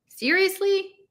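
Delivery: high, incredulous "Seriously?"; disbelieving. Acted. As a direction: push the word up in disbelief. Disbelieving echo.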